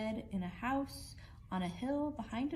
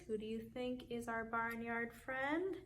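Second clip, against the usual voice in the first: plain reading voice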